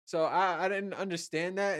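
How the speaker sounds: normal talking voice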